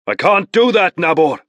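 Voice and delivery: loud, high call over his shoulder; strained call between strokes. Acted. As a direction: call back across the water while rowing. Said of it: "he called over the stern".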